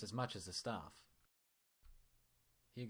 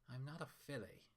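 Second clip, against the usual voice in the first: mildly sour, softer grumble; 6 semitones higher, 7 dB quieter than usual. Acted. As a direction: grumble it under your breath, a touch sour. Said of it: "she grumbled sourly".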